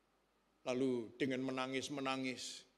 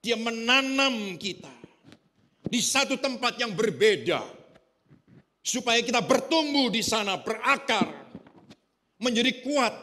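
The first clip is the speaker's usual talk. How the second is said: full-force preaching; 8 semitones higher, 10 dB louder than usual. Acted. Pastor to his congregation about being planted, preaching at full force. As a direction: pound out each phrase to the congregation.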